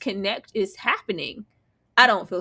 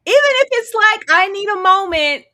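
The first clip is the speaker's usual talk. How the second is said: louder and higher; heated; 10 semitones higher, 9 dB louder than usual. Real, not acted. Host heated about husband leaving, louder and higher. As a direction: push it with heat.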